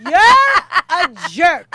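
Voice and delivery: loud laughing slow jab; laughing shout. Real, not acted. Teasing her friend about her father, shouting through laughter.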